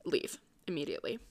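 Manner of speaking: fast, soft whisper. Real, not acted. Vlogger urging viewers to leave a bad boyfriend, whispered fast. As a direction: lean in and whisper the advice.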